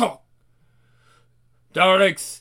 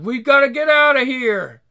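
ordinary delivery

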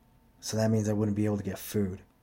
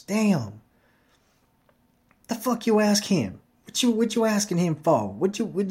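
high, loud mock yelling at his sister; kid yelling at sister; 10 semitones higher, 6 dB louder than usual. Kid yelling at his pushy sister, high and loud. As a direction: shout it up high like an annoyed kid. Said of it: "when he stood next to this woman".

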